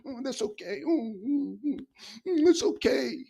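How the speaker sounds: soft, mocking baby-soothing coo; lightly voiced mock nurturer soothing a crybaby. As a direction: hum it like comforting a baby, in mockery.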